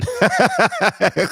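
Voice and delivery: high bursting laugh; big laugh. Real, not acted. Laughing at own story.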